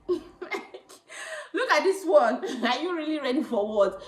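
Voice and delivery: mocking through laughter; laughing. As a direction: tease her while laughing. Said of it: "he's like".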